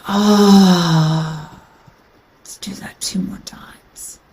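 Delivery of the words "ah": long, open "ah" on the exhale; deep sigh. Shown as a demonstration. Teacher showing a listener how to exhale, deep sigh. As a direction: let out a full sighing breath. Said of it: "deep sigh".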